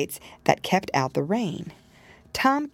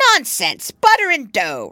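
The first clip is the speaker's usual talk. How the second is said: loud, scornful rat wife; a rat wife brushing him off; old woman rat voice; 5 semitones higher, 7 dB louder than usual. Acted. Anna Maria retorts.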